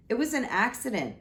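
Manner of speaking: the dragon defending himself; roaring protest. Acted. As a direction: roar out the excuse. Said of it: "he roared".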